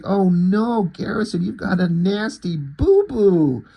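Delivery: high, mock-concerned baby talk; goofy babying. She fusses over Garrison's little scratch, goofy babying. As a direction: coo over a tiny injury, hammed-up. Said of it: "she gets this goofy look".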